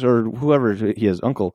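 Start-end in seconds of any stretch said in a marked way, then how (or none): none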